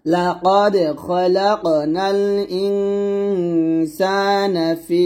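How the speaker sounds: slow melodic chant with a heavy ক in লাকাদ; Quran recitation. Shown as a demonstration. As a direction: sing it out stretched.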